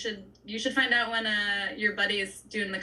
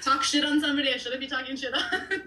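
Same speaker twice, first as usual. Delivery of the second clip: laughing; 5 semitones higher than usual. Retold or imitated. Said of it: "But then I'm just like".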